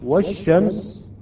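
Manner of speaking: "والشمس" with the l silent and a doubled sh, wash-shams; lam assimilated. Shown as a demonstration. Teacher showing the silent lam, "والشمس" slowly as wash-shams. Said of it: "يعني إذا نقول".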